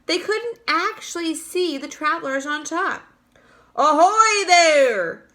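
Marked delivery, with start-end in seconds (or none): 3.8-5.3 slow, loud, higher hail; shouting. A narrator's reading. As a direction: call it up to the roof like a police chief. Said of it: "shouted the chief of police".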